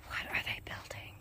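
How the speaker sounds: whispered to the camera. Real, not acted. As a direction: breathe it out like a secret. Wondering aloud quietly.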